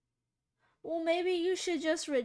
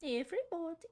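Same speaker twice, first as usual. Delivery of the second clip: posh British woman; 3 semitones higher than usual. Retold or imitated. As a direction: stretch it out like a prim British lady. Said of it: "Who said that?".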